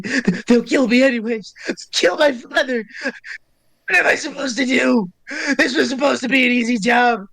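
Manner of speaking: high, tearful shriek of a desperate gnome; screaming gnome. Acted. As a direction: plead in a high put-on voice, sobbing and frantic. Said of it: "as he screams that at you".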